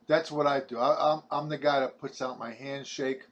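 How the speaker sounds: usual speaking voice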